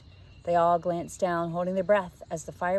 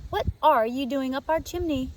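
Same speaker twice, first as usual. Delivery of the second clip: put-on little girl; 6 semitones higher, 5 dB louder than usual. A narrator's reading. Emma surprised at chimney.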